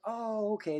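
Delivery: imitated high, drawn-out "oh" of realization; dawning understanding. Axe throwers learn he is a first-timer, dawning understanding. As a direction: mimic a group suddenly getting it. Crowd's attitude softens on hearing newcomer explanation.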